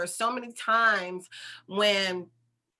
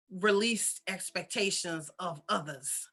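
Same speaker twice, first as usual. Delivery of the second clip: ordinary delivery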